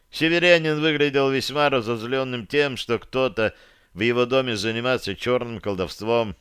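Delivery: usual speaking voice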